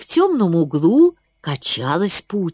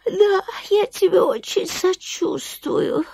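sighing sympathy; 11 semitones higher than usual. Acted. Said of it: "вздохнула мышь".

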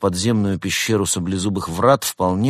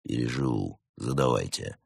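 low, soft, terse reply; dry low-voiced operative; stoic agent; 7 semitones lower, 6 dB quieter than usual. A narrator's reading. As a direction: answer unimpressed, well below usual pitch. Stoic agent answering technician.

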